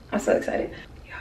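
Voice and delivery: a secret little squeal of joy; excited whisper. Real, not acted. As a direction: breathe it out in a giddy whisper.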